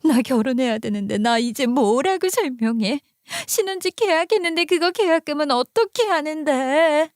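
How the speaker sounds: high, wailing sister-in-law; acted crying. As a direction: lament as if sobbing, pitch climbing. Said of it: "엉엉 울기 시작했죠". (엉엉 우는 듯 높은 소리로)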